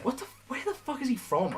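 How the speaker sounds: mock bewildered comment; baffled viewers. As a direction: sound totally confused by the accent. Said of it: "They were like".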